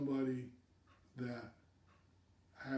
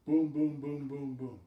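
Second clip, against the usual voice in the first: punchy boom; 7 dB louder than usual. Real, not acted. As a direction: tick off each test with a louder boom. Rapid tests done one after another.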